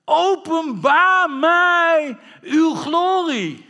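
high, slow, full-voiced invocation; crying out in prayer. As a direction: call on heaven at full force. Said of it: "hem aanroepen en zeggen". (luidkeels biddend aanroepen)

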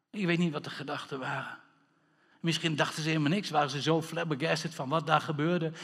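normal talking voice

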